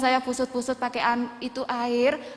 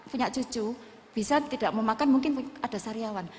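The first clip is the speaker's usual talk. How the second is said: ordinary talk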